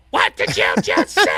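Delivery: high, loud outraged shout; screaming in outrage. Retold or imitated. Opponent pouncing on partner's slip, outraged shout. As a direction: shout it like you can't believe your ears. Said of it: "They're screaming".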